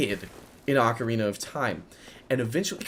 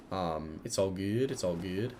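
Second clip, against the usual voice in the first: quiet reassurance; 5 semitones lower, 10 dB quieter than usual. Real, not acted. Calming self after failed summon.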